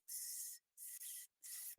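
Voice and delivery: whispered hissing sss; snake hiss. Shown as a demonstration. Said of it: "It sounds like".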